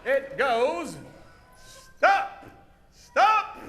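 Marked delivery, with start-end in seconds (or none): 2.0-3.6 high, sharp hero's command; hero's yell. Shown as a demonstration. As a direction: yell it out like a stage hero. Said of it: "Let's watch a little closer".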